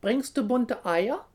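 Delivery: high, loud question; questioning geese. A narrator's reading. Geese ask the little hare back, calling. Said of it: "fragen sie zurück".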